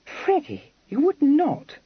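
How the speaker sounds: high, alarmed protest in disbelief; shocked exclamation. A narrator's reading. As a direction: gasp it out, stricken and pale. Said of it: "exclaimed Miss Charing, turning pale".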